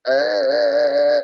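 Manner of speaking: very loud mock wail; long hammed-up crying noise; demonstrated loud crying. Shown as a demonstration. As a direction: bawl at full volume, playfully. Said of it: "nangisnya kencang-kencang".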